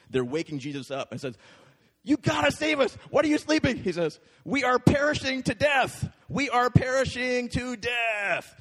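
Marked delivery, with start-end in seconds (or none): 2.1-8.5 high, loud frantic pleading; terrified disciples begging for rescue; panicked cry. Acted. Disciples waking Jesus in the storm, panicked high cry.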